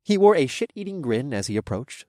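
ordinary delivery

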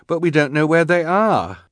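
worried. A narrator's reading. Klaus worried.

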